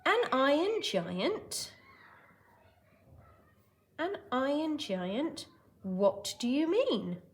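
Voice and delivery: slow, puzzled father; Hogarth's dad. Acted. Teacher as Dad questioning his son, lightly acted puzzlement. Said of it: "Hogarth's father replies".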